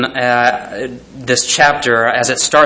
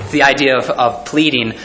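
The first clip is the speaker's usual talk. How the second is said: usual speaking voice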